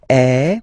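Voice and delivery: loud open eh; open French è. Shown as a demonstration. Teacher recapping accent grave sound. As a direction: open the jaw for è. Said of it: "accent grave".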